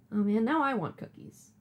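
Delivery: usual speaking voice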